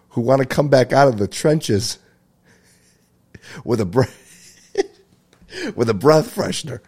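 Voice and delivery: high, loud words broken by laughter; cracking up. Real, not acted. Comic telling listeners his own joke, cracking up. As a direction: try to get the joke out through laughs.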